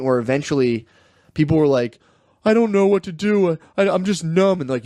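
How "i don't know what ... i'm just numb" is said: high-pitched, overwrought imitation; exaggerated lost-and-helpless voice; mock helpless confession. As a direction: whimper it like someone overwhelmed. Mimicking overwhelmed people on video.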